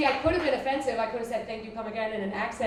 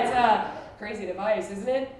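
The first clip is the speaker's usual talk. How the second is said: ordinary delivery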